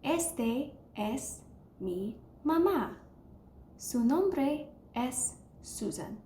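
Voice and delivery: slow, careful teacher read of Este es mi mamá; unhurried model sentence for students to echo; slow Spanish repeat. Shown as a demonstration. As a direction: spell Su nombre es Susan out slowly for learners. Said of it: "Let's try one more time".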